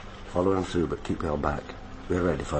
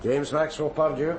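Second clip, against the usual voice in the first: firm, raised and higher as he grabs the suspect; arrest call; 10 semitones higher, 3 dB louder than usual. Acted. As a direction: announce the arrest over the noise.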